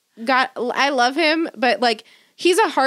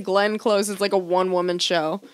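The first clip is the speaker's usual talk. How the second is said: normal talking voice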